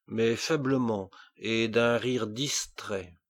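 plain reading voice

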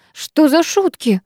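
выше и медленно, с лёгким испугом; спроси, слегка дрожа от страха; дрожащим голосом. Acted. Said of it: "Дрожащим от страха голосом". (испуганно, медленно, чуть дрожащим голосом)

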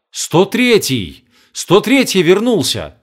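overjoyed, drawn-out cry of recognition; excited. Acted. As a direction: call it out in delighted disbelief. Old man joyfully recognizing ant.

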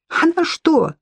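high shrieking question; shrieked. A narrator's reading. Wet Lidia to Sudansky about Sofya, shrieking. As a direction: shriek it in outrage. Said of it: "завизжала мокрая".

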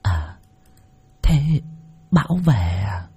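soft, half-whispered question; dawning "ah". A narrator's reading. Old woman finally catching the neighbour's warning, softly realising. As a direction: let it sink in quietly, almost under the breath. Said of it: "ả lên một tiếng".

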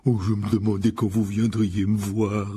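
slow, gruffly welcoming big man; rough gamekeeper. A narrator's reading. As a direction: greet visitors in a slow, lumbering voice. Voices giant gamekeeper Hagrid. (garde-chasse bourru, débit lent)

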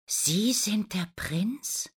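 slow, breathless whispered question rising at the end; surprised whisper. Acted. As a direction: gasp it out in disbelief. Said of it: "fragt Lina überrascht".

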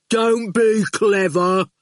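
slow, heavy-witted thug, words dropped one by one; menacing henchman taking his time; slow lumbering thug; slow goon voice. Acted.

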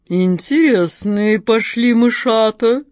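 slow, purring cat in a put-on bass voice; deep purr. A narrator's reading. The cat purrs menacingly at her trapped prey, slow bass purr. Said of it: "басом промурлыкала кошка Мурка".

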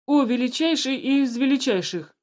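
loud servile address to the ruler; formal servile address. A narrator's reading. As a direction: step forward and bow with words. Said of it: "вышел вперёд начальник охраны".

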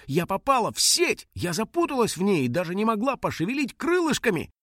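high-pitched, aggrieved little creature; high, worked-up retelling; indignant little dragon. Acted. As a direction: complain in a small high voice. Indignant dragon recounts being trapped.

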